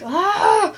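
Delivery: loud high yelp of outrage; mock gasp of offense at mean girls; shocked yelp. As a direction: yelp at the insult. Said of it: "I'm like".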